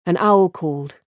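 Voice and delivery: plain reading voice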